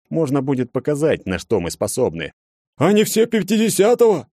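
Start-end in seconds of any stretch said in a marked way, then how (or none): 2.8-4.3 high, loud scared cry; panicked shout. Acted. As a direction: shout it in terror. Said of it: "в панике закричал".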